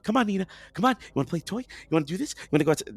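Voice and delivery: high, eager pet-talk, re-enacted; coaxing dog owner. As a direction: lift it high to lure a dog into play. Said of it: "So I'm like".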